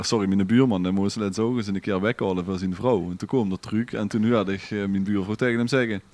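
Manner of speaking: ordinary talk, nothing marked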